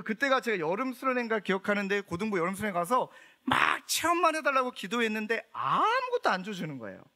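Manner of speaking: usual speaking voice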